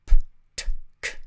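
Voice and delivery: p t k, unvoiced puffs of air; teacher demonstrating p, t, k as voiceless bursts; voiceless sounds. Shown as a demonstration. Teacher to learners, demonstrating voiceless p, t, k. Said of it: "What are those? voiceless".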